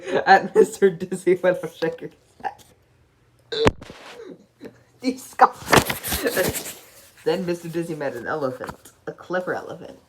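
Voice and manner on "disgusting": giggling, breathy word through laughter; laughing too hard to speak. Real, not acted. Narrator breaks into giggles.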